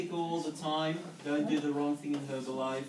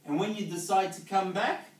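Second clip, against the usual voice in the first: louder, pumped, rallying the crowd; like a coach firing up his team; full preaching mode; 4 semitones higher, 5 dB louder than usual. Acted. Speaker rallying distributors with his philosophy, loud and fervent.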